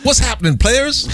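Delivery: cool greeting. Pretend. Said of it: "He's like".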